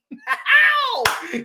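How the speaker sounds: very high, held yowl; country-singer yelp; high cowboy whoop. Shown as a demonstration. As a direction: cap the verse with a piercing holler. Whoop ending the sung verse.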